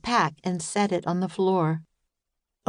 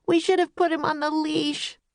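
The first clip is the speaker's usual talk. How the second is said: high upset regret; a touch of a wail; mild wail; 10 semitones higher than usual. A narrator's reading. Annie wailing to Jack about the leash. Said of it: "wailed Annie".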